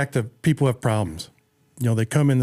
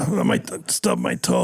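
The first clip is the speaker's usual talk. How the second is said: sick voice; slower than usual. Pretend. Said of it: "what are you talking like that for?".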